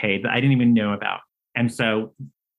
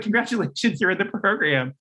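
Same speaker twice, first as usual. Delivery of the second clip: awkward congratulation; 8 semitones higher than usual. Retold or imitated. Caller retells the good news awkwardly.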